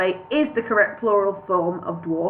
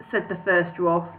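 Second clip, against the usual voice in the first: plain reading voice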